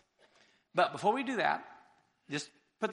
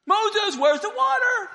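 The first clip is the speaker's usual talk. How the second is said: much higher, loud repeated call; shrill nagging call; 12 semitones higher, 9 dB louder, slower than usual. Acted. Crowd outside the tent calling Moses again, higher and louder. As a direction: pester him, higher and louder.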